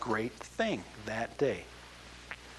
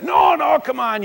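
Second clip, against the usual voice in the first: pleading shout; 11 semitones higher, 12 dB louder than usual. Retold or imitated. Johnny urging Oral to sing.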